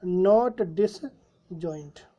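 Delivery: drawn out very slowly while writing; slow dictation. Shown as a demonstration. Teacher writing the answer on the board, very slow. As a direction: dictate it word by word. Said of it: "इसका मतलब वो क्या हो गया?".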